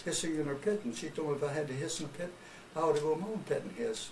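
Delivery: put-on a child whimpering his story to mom; crying little snake. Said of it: "Petey, why are you crying?".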